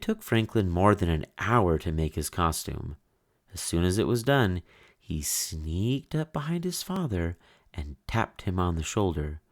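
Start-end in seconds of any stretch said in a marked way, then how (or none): none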